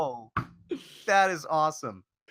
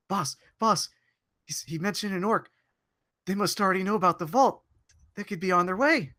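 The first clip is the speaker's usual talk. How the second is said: high, eager goblin lackey; 7 semitones higher than usual. Acted. Player's lackey warning the goblin boss, higher. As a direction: warn the boss urgently. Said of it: "I wanted to point out".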